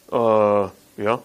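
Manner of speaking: low, reluctant mumbled agreement; sheepish worker. Retold or imitated. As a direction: grudgingly admit it under your breath. Worker answering the landowner.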